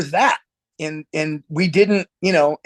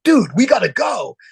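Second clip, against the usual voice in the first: urgent; 6 semitones higher, 4 dB louder than usual. Retold or imitated. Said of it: "And he's like".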